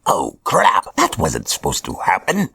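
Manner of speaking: a hint of flustered supervillain; lightly voiced villain. Hobgoblin as Roderick dies, mildly flustered villain. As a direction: mildly caught off guard.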